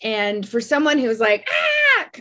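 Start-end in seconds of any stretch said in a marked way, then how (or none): 1.5-2.1 short, high-pitched mock scream; kooky loud yell. Comic showing how loud she is, kooky mock scream. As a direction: let out a zany high "ah". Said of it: "loud and kooky sometimes".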